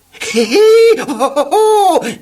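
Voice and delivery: very high, loud giggling hee hee ho ho; being tickled by Mister Tickle, very high, loud giggling; ticklish laughing. A narrator's reading. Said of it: "I laughed".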